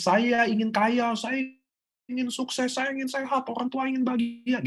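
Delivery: very high chanted wishes, demonstrating; high-pitched demo of shouted affirmations; affirmation cry. Shown as a demonstration. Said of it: "teriak-teriak seteriak-teriaknya".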